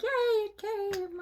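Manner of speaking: high, drawn-out mock cheer; re-enacted squeal of delight, stretched out; singsong high-pitched yay; excited. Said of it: "I'm so excited when I get the package".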